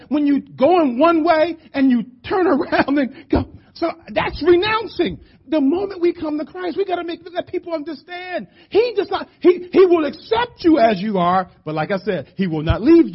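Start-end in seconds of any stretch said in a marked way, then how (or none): 0.1-11.5 high, urgent preaching voice; impassioned preaching. Real, not acted. Preacher urging stronger gospel preaching to church, impassioned preaching. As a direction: press it on the congregation with raised pitch.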